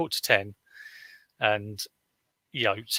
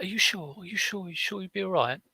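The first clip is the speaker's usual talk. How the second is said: concerned church woman; 8 semitones higher than usual. Retold or imitated. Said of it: "so she was like".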